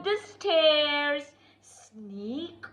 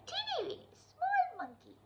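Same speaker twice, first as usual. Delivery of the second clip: put-on high, soft baby-talk; cutesy voice; 11 semitones higher, 11 dB quieter, faster than usual. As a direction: make it sound tiny and cute. Cutesy voice for tiny monkey.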